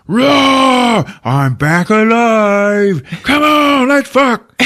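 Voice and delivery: very high, loud monster bellow; hammed-up monster roaring back to life; roaring beast. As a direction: stretch it into a triumphant mock roar. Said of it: "the beast is like".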